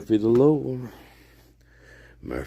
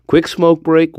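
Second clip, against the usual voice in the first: loud call; 4 semitones higher, 8 dB louder than usual. Real, not acted. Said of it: "We need a smoke break here".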